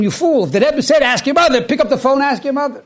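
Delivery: mock exasperated scolding. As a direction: tell him off for missing it. Bystanders scolding him.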